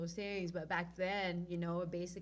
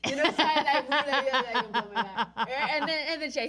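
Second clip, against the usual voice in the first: loud excited retelling; 6 semitones higher, 12 dB louder than usual. Retold or imitated. Acting out the dramatic move.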